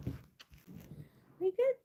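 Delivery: very high, slow cooing; drawn-out high coo to a pet. Real, not acted.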